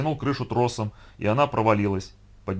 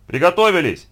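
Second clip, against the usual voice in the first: high, loud director's call; loud standby order; shouting through megaphone; 6 semitones higher, 7 dB louder than usual. A narrator's reading. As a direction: shout it to the whole set. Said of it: "закричал в рупор Яков Борисович".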